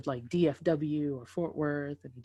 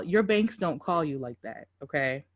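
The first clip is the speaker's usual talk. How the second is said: ordinary talk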